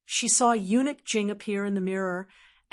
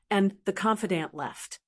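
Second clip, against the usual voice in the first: ordinary delivery, nothing marked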